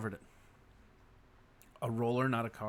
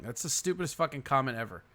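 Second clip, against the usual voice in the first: normal talking voice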